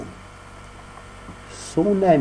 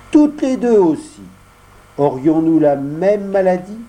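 put-on higher boy voices bargaining; two boys haggling; voix d'enfant; 8 semitones higher than usual. Dialogue des deux amis. (voix d'enfants qui marchandent)

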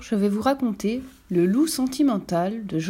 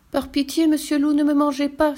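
put-on high, loud pleading girl; child begging; 7 semitones higher, 4 dB louder than usual. A narrator's reading. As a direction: beg for mercy like a scared child. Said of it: "supplie le petit chaperon rouge".

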